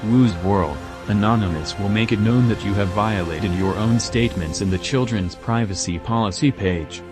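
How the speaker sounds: ordinary talk, nothing marked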